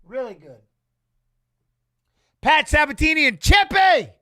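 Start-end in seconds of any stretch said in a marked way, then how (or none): 2.4-4.1 louder, higher hype call for the fighters; excited shout. Real, not acted. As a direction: yell their names like a fan at the arena. Hyped for fighters walking out.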